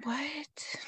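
a stunned, breathless whispered what; shocked whisper. Real, not acted. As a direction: whisper it in disbelief. Shocked at trial run request.

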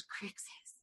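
a hushed, breathless whisper; whispered name. Retold or imitated. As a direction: lean in and whisper the name like a secret. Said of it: "he leans in and he goes".

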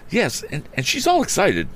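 put-on high, agitated boy's answer; excited youth. A narrator's reading. Son tells father about the housekeeper, high and excited. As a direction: report it in an excited, high-pitched voice.

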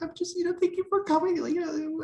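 high-pitched mock teary voice; imitated tearful thanks. Stepmom tearing up after the coming out, imitated high tearful thanks. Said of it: "started, like, tearing up".

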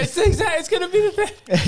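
laughing insistence. Real, not acted.

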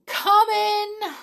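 squeaky high call from inside. Acted. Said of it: "said a voice".

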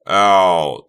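loud pained howl. Acted. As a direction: howl as the leg moves. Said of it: "Doug howled".

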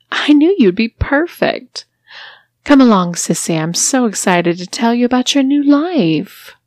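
delighted with her new toy; gloating mistress. Acted. Mistress welcomes her new sissy, loud and gloating.

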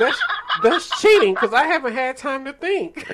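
loud, high playful protest; loud laughing protest. Real, not acted. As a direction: call foul, laughing loudly.

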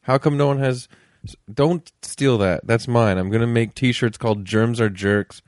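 ordinary delivery

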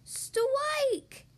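put-on high, drawn-out childlike cry; little Polly's yell. A narrator's reading. Small frog's ball reaches the pin, high drawn-out yell. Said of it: "yelled Polly".

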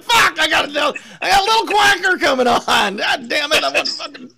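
high squawking duck voice, cursing; Donald Duck quack. Retold or imitated. Dad cursing as Donald Duck, high squawky duck voice. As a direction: quack it out like an angry cartoon duck. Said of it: "the Donald Duck thing".

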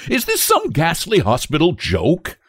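high-pitched indignant challenge; enraged. A narrator's reading. Father confronts the nurse, enraged. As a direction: demand an answer, terror turning to rage. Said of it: "his terror resolving into rage".

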